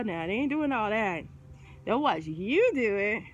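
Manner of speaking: ordinary talk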